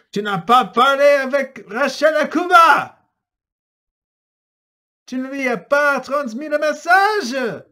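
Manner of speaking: loud, high furious yell; furious shout. Acted. Antoine yelling at Philippe, furious and loud. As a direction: scream the accusation at him. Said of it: "furieux, et crie".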